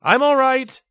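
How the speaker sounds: loud, high call across a distance; shouted reassurance, high and loud. Acted. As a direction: call out to be heard down the street. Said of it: "he called".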